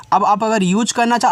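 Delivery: ordinary talk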